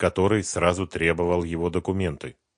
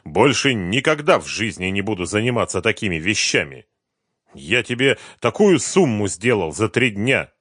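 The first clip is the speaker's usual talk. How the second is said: indignant; 6 semitones higher, 9 dB louder than usual. Acted. Partner angrily defends himself.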